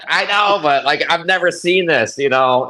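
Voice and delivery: high-pitched defensive excitement; retold eager self-defence; excited protest. Retold protest to his wife.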